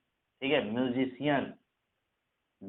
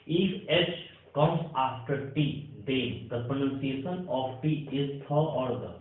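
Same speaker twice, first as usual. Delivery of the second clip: slow, deliberate rule dictation; much slower than usual. Shown as a demonstration. Teacher to Hindi students, dictating the rule slowly. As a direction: spell out the rule word by word for students.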